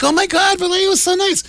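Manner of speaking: put-on very high gushing girl voice; gushing girlfriend. Girlfriend arriving in Vallejo, high gushing voice. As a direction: gush like a starstruck young woman. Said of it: "she's like".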